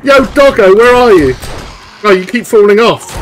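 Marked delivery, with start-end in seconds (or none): 0.0-1.3 calling out. Real, not acted. Calling out to her dog.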